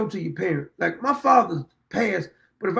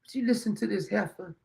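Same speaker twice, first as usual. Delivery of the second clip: said to myself; 8 dB quieter than usual. Retold or imitated. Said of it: "And I said to myself".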